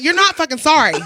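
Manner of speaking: loud, high mock-angry retort; retold telling-off. Bartender rejects drunk customer's apology, retold telling-off. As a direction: throw the apology back loudly. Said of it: "He got yelled at too".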